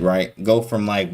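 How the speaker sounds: usual speaking voice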